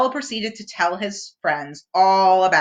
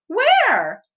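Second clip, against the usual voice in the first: Piglet voice; 12 semitones higher, 4 dB louder than usual. A narrator's reading. Piglet voiced in read story.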